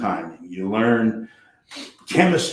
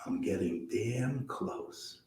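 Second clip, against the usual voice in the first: softer, slow, confiding; leaning in quietly; 3 semitones higher, 13 dB quieter, much slower than usual. Retold or imitated. Charlie answering Jack at dinner, soft slow confiding. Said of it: "leans in and says".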